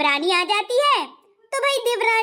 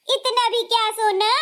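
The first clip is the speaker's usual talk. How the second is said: ordinary delivery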